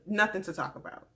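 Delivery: normal talking voice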